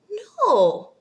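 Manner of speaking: high, loud no; a high, loud flat refusal; emphatic no. Real, not acted. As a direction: shoot it down hard. Rejecting crude pickup line.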